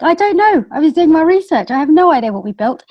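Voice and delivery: high, loud mock-defensive retelling; flustered protest. Founder retelling her answer in Microsoft's office, flustered protest. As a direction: blurt it out as a comically helpless excuse.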